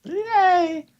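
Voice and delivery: a fake little celebration; mock cheer. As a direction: reader mock-cheering the story, high. Mock cheer after reading.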